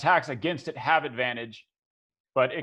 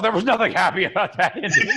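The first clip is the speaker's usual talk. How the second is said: raised, louder mock indignation; imitated outrage; 5 semitones higher, 5 dB louder, faster than usual. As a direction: retell an angry protest, half laughing. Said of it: "uh, she was pissed".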